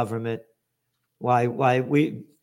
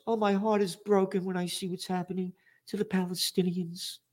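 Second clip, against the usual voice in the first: mocking quote; 4 semitones higher, 8 dB quieter than usual. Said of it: "This guy at Davos".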